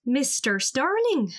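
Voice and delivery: slow, put-on Irish accent introducing a new friend. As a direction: lay on an Irish lilt, drawn out on the name. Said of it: "Irish accent".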